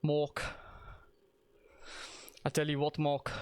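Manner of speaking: a light hint of a South African accent, barely shifted from own voice; warm-up line in a lightly done South African accent; South African Sam voice. Shown as a demonstration. Streamer's warm-up phrase for slipping into a South African accent, lightly done. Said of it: "South African Sams from Auntie Donna".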